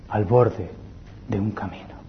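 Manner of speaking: breathy whispered close. Acted. Preacher ending plea, whispered. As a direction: let it fade to a whisper.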